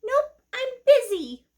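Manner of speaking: high squeaky kitty, dismissive; a high little cat too busy to help. Acted. Cat character in the story.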